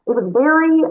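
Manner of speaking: ordinary talk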